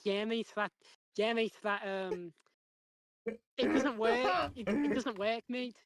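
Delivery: imitating a thick Scouse bloke; Scouse accent. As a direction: do a mock Liverpool accent, emphatic and clipped off from own voice. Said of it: "because of how scouse he was".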